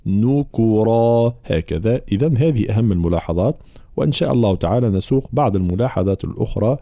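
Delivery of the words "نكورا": نكورا slow with rounded ن and ك; clear damma. Shown as a demonstration. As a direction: round both vowels in نكورا. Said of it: "بيان ضم النون والكاف". (ضم النون والكاف)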